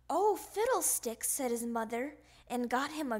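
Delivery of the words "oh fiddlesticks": exasperated. Acted. Tired mother exasperated.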